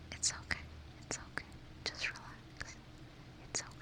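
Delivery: very soft, far-off whispered relax; ASMR trigger words, whispered very softly; close-up trigger words. Pretend. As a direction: breathe each relax right into the mic. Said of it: "Some close-up trigger words? Yeah, of course".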